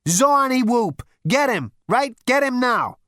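loud, high, bossy yell; demanding shout. Acted. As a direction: bark orders at the desk. Zaphod shouting at receptionist.

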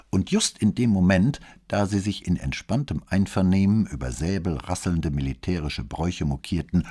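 ordinary delivery

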